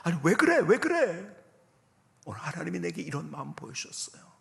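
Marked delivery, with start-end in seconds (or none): 0.0-1.3 loud, higher-pitched alarmed questions; startled mother. Retold or imitated. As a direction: imitate a mother suddenly alarmed on the phone. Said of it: "저희 어머니가 깜짝 놀라시더라고요".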